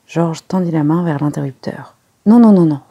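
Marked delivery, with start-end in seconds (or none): 2.3-2.8 loud, high repeated no; Poirot shouting. A narrator's reading. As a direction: shout to stop him touching the switch. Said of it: "cria Poirot". (cri de Poirot, fort et aigu)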